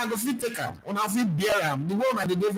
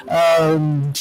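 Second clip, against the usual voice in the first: loud emphatic answer; 9 dB louder than usual. Real, not acted.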